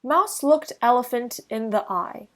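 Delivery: plain reading voice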